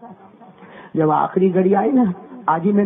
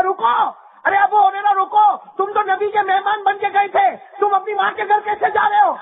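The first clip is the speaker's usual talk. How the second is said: shouted halt; 12 semitones higher than usual. Acted. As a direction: yell after him to stop in the street. Stranger stops Abu Hurairah.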